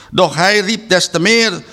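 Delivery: ordinary delivery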